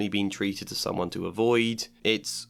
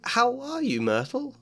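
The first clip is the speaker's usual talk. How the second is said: high, forced cheerful greeting; falsely bright; 13 semitones higher than usual. Acted. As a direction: fake sweetness, pitched up. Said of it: "in a falsely bright voice".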